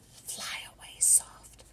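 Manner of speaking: very soft whisper. Shown as a demonstration. Librarian whispering to the soft bluebird. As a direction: breathe it out gently.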